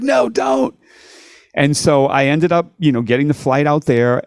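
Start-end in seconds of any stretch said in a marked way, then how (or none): 0.0-0.7 put-on British accent. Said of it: "and he's like".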